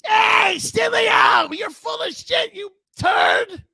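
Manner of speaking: high, screaming insult at full volume; hollered heckle. Acted. Imitating a caller who heckles the host, high screaming yell.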